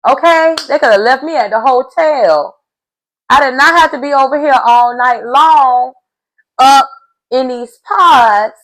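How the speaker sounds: building mock outrage at the date; indignant rant. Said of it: "She was like".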